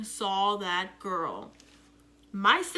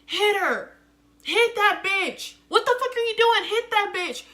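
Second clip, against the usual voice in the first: much higher, louder yelling; screaming; 10 semitones higher, 7 dB louder than usual. Retold or imitated. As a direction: scream at her to hit the girl. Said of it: "my sister screaming".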